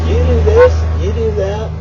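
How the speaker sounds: squeaky high sarcastic gush, then back down; sarcastic mock awe. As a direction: gush with fake amazement, mockingly. Sarcastic mock admiration of a fan.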